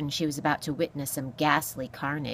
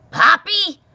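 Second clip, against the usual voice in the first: loud, high incredulous squawk; scornful echo; 12 semitones higher, 12 dB louder than usual. Acted. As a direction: throw the name back in disbelief. Porcupine repeats the name scornfully.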